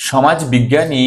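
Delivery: usual speaking voice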